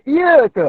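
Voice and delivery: "iya ke" high, like a Malay question, single y; mock misreading. Shown as a demonstration. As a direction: ask "iya ke" as if doubting. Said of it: "Macam gitu". ("iya ke" macam bertanya, tanpa sabdu)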